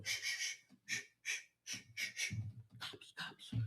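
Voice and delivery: hushed frantic whisper, barely breath; paranoid whisper. Retold or imitated. Reenacting his drug days at the window, whispering in fear. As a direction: hiss for silence like the cops are outside. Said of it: "peeking out windows like this".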